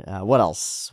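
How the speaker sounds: normal talking voice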